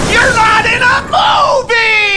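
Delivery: yelling at the screen at Crowder, angry shout. Real, not acted. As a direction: yell it out, fed up. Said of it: "I'm mad".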